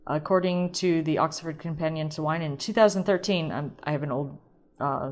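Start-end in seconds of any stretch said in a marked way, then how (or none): none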